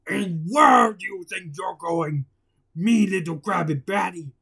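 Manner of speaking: block the way and growl it out; Mister Krabs voice. Acted. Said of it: "Mister Krabs blocked the way".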